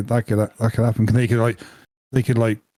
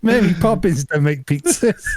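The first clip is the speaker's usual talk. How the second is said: laughing; 6 semitones higher than usual. Real, not acted. Still laughing.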